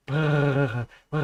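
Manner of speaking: rolling purr through the lips; imitated cat purr. Copying a friend's purring cat for viewers, lips rolling like a motor. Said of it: "you can hear the cat like a fucking motor".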